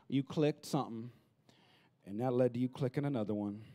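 usual speaking voice

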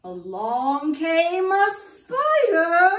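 slow, loud, creepy announcement; spooky build-up. Acted. Storyteller scaring children as the spider arrives, slow and loud. Scary climax of the rhyme.

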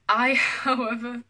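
slow grand announcement cracked by a laugh; breaking into laughter. Real, not acted. Vlogger reading childhood villain monologue, laughing mid-declaration. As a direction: try to declaim it but laugh midway.

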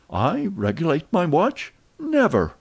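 rising outrage to a high Never; scandalised refusal; defiant protest, peaking high; indignant. Acted. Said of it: "with a defiant gesture".

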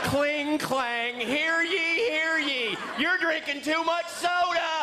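high, slow mock town crier proclamation; hammed-up town crier shouting. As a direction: call it out like a medieval herald with a bell. Town crier announcing soda excess.

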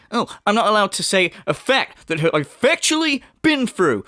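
high, mock-aggrieved imitation; mimicking Phil. Commentator mimicking the streamer's reply to the comment, mock aggrieved. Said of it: "He just said".

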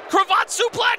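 high shouted move call; fired-up commentator shout; excited play-by-play. Real, not acted. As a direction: yell the throw as it happens. Calling a move live.

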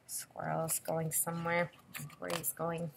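usual speaking voice

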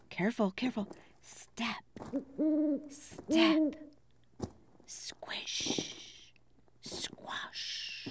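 soft tiptoeing whisper; quiet careful whisper; sneaky whisper. Acted. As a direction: whisper each step as if sneaking. Tiptoeing through forest.

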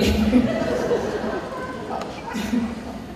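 high breathy laugh; high airy laughter; real laugh. Real, not acted. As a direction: laugh at your own parrot voice. Laughs at parrot impression.

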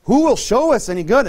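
loud, high-pitched plaintive question; plaintive appeal. Retold or imitated. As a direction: imitate people asking in need. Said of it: "it's kinda sad. You know, they're saying".

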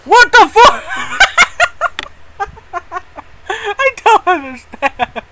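cracking up at the absurdity; real giggling bafflement at the game; incredulous laughter. Real, not acted.

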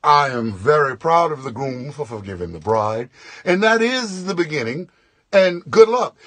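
low, stiff official announcement; a low, stiff MC sign-off; awkward formal toast. Retold or imitated. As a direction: wrap it up flatly and bail. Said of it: "all I could think to do was".

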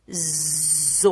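"Zou" with a long buzzing Z; sounding out. Shown as a demonstration. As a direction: hold the Z, then stretch the vowel of "Zou". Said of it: "O som das letras".

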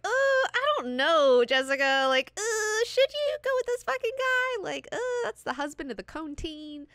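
exaggerated hemming and hawing, way up high; reluctant whine. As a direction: play up the doubt in a squealy high voice. Voices a doubtful character's reluctant reply.

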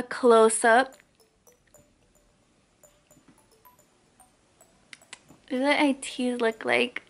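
speaking with finger on lip; lip pulled down by finger. Real, not acted. Seen 1: fingers pull lower lip down, baring braces while speaking.

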